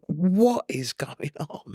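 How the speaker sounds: high, slow, baffled; slowed, high bewildered inner voice. Retold or imitated. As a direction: drag it out in disbelief at yourself. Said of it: "and I think".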